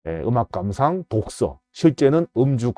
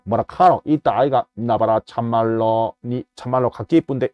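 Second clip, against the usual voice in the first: a lightly done Busan dialect, lower; 4 semitones lower, 4 dB quieter than usual. Retold or imitated. A lecturer imitates Busan speech for the audience, light Busan dialect. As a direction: slip into a casual Busan dialect. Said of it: "부산말이 표준말이지 않을까요".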